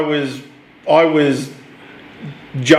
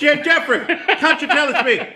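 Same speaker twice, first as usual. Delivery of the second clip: put-on higher, laughing stage-Irish accent; mock Irish accent; 10 semitones higher than usual. Colin Farrell teasing Jeffrey Wright, laughing Irish accent. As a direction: tease Jeffrey in a joking Irish lilt. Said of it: "Colin put his Irish accent".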